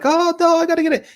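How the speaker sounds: high-pitched frantic imitation; mock a man in total panic; hammed-up panicked wail, very high; losing it. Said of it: "He loses it".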